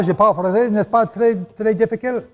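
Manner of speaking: practicing French with a French accent; demonstrated French accent. Shown as a demonstration. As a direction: put on a French accent, placing the stresses. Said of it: "put the accents wherever the French words would".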